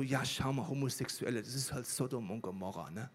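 low, quiet know-it-all voice. Acted. Preacher mimics Christians blaming homosexuals, mock judgmental. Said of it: "verwendet, so".

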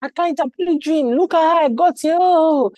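high, hammed-up wail of self-pity; mock woeful lament. Prisoner declaring his woes to fellow prisoners, mock woeful lament. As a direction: bemoan your lot in an exaggerated singsong. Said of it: "declaring his woes".

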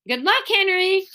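understated shout of encouragement; mild shout. A narrator's reading. Beezus wishes Henry luck in the contest, mild shout. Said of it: "shouted Beezus".

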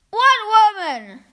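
slow, high, loud puzzled call; grandma calls up puzzled to the boy; calling back. Acted. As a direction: call up in confusion. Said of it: "my grandmother called back".

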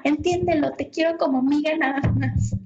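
high, half-volume retold shout; retold yell. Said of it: "y me grita".

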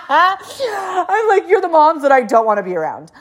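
high, amused mock confession; laughing. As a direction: say it through the tail of a laugh. Laughing at her own remark.

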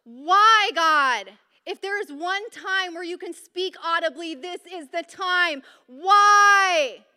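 loud, high, desperate cry of why; anguished scream at God. Retold or imitated. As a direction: scream it out to God alone in the car. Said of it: "she screamed in her car".

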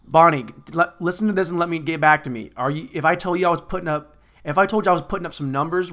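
ordinary delivery

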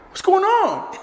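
high, loud squeaky voice; startled friend. Retold or imitated. Younger friend walking in, high loud squeak. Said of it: "He goes".

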